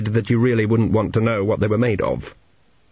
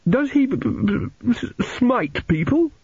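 put-on high, stuttering child's question; fearful stammering boy; 12 semitones higher, slower than usual. A narrator's reading. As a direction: stutter timidly up to the dreaded word. Narrator voices fearful Arthur.

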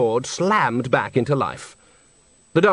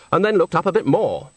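ordinary delivery, nothing marked

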